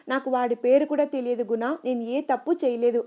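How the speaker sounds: usual speaking voice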